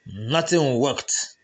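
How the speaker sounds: plain reading voice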